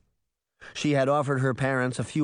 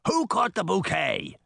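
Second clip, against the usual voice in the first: roared question; 7 semitones higher than usual. A narrator's reading. Titan asks who caught the bouquet, mild roar. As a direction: call it out high, a roar held in check. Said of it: "Titan roared".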